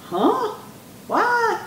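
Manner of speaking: very high, questioning squeak; baffled surprise. A narrator's reading. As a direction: react in high-pitched disbelief. Underdogs baffled by funeral announcement.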